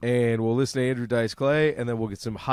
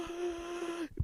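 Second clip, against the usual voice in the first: sharp high gasp on waking; startled gasp; 14 dB quieter than usual. Retold or imitated. Woken by an explosion, a sharp frightened gasp. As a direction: gasp as if jolted from sleep.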